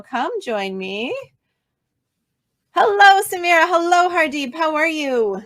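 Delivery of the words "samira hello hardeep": usual speaking voice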